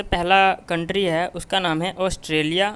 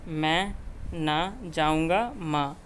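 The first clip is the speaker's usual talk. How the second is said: slow mnemonic demo; much slower than usual. Shown as a demonstration. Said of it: "देखिए कैसे".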